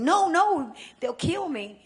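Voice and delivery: loud, high panicked no; retold terrified protest, loud and high; frightened plea. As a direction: cry out in fear of being killed.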